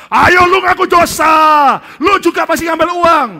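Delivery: high, loud, slow badgering; pushy shout. Two pastors pressing the third to confess, loud high badgering. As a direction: drag the confession out of him.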